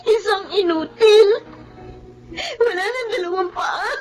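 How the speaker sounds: sobbing, fading to a broken hush; crying. Acted. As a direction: cry it out, then almost no voice. Daughter despairing over lost legs.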